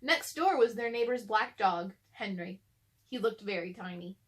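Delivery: usual speaking voice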